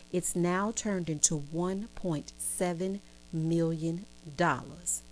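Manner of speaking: slow, low, measured announcement; dramatic reveal. Retold or imitated. She tells Zach his stock windfall, slow dramatic reveal. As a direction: drop low and draw out the big figure.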